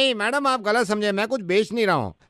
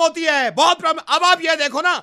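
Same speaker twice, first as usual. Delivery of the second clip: heated complaint at raised pitch; argumentative tone; 4 semitones higher than usual. Acted. Suitor insists he is in a great mood, argumentatively. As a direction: claim to be happy while sounding cross. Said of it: "tone है ये".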